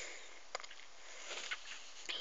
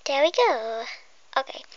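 ordinary talk

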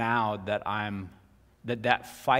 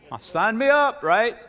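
high, loud eager sign-up; mock eager; 13 semitones higher, 11 dB louder than usual. Preacher mock-volunteering for God's peace. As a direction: jump at the offer, half joking. Eager reply to own question.

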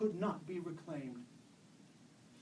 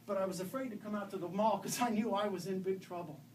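usual speaking voice

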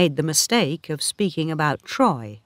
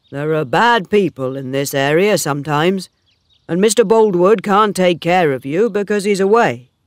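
louder, worried suitor's voice; lightly voiced Oak, concerned; 7 dB louder than usual. Oak meets Bathsheba walking home, lightly voiced and concerned. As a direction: lightly play a concerned farmhand, voice raised.

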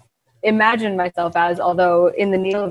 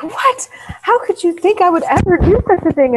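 high, wide-eyed mock disbelief; shocked innocence; 10 semitones higher than usual. Thief protesting her innocence to the merchant, high and shocked. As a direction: play the wronged innocent, pitch way up. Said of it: "I'm like".

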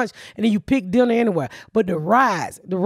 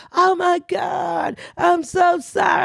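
mock apologetic clerk; 6 semitones higher, much slower than usual. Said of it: "She's like".

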